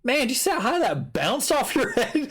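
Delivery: mock awe at how high it bounced; amazed friends. Teen friends gawking at the bounce, mock amazed. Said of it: "my friends were like".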